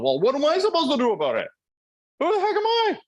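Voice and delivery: very high, put-on squeaky voice; mock helpless whiner. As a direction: squeal the excuse in a mocking falsetto. Mocks the powerless person's excuse.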